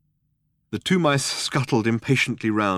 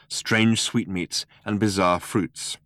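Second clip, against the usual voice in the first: ordinary delivery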